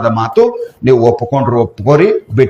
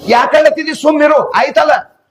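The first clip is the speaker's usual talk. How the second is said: puzzled onlooker; 12 semitones higher than usual. Acted. Onlooker asks why devotee cries.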